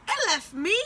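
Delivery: high, loud incredulous question; indignant burst, voice leaping up; outraged disbelief. Retold or imitated. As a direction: throw it back in shocked outrage. Outraged disbelief at missed bus.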